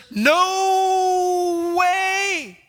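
high, loud, drawn-out "No way"; shouted refusal. Acted. Peter refusing God, shouted and drawn out. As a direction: yell it as a flat refusal. Another translation acted.

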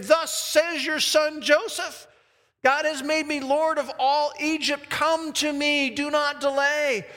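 high, insistent, ringing message; urgent proclamation. Acted. Joseph sends word to his father Jacob, urgent high proclamation. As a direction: keep the pitch up and drive each command home.

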